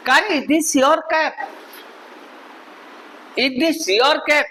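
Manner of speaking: slow demo question, tone moving for learners; model question intonation. Shown as a demonstration. Teacher showing students question tone, modelled intonation. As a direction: model "Is this your cap?" slowly with clear intonation. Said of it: "pronunciation देखिएगा और tone देखिएगा".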